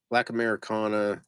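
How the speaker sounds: ordinary delivery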